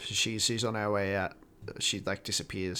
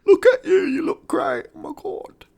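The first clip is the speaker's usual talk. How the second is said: very high, loud mock sobbing gush; fake-weep over your hero; hammed-up crying; 12 dB louder than usual. Mocking [name] weeping over [name], very high.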